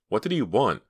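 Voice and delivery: worried question; anxious. A narrator's reading. First asking.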